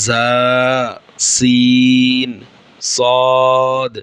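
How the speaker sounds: জা, সিন, সদ each held long and clear, sin stretched very slowly; Arabic letter sounds. Shown as a demonstration. Teacher demonstrating জা, সিন, সদ to students, drawn out. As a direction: sound out জা, সিন, সদ crisply, one by one like a Quran teacher. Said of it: "এই তিনটি হরফ উচ্চারিত হয়".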